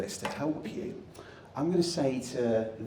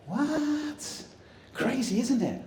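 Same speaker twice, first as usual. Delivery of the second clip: much higher, stretched "What?"; mock amazement; 11 semitones higher, much slower than usual. Speaker acts astonished for the audience, high stretched disbelief. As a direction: feign shock at the trick.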